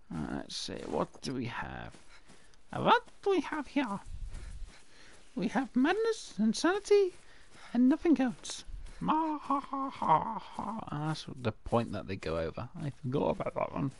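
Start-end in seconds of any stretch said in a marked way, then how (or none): 2.7-8.6 much higher theatrical madman voice; a high, hammed-up cartoon lunatic listing madness; mad villain. 9.0-10.8 slow, high hammed-up evil cackle; a slow, high mock villain's laugh.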